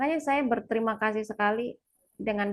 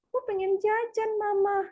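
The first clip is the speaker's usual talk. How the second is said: put-on child's plea; 10 semitones higher than usual. Child asking for snacks.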